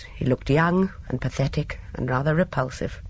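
plain reading voice

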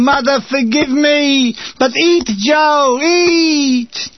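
high, slow, crying plea; a hammed-up cry to his mother; anguished shout. A narrator's reading. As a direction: beat your breast and wail it. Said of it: "beating his breast and shouting".

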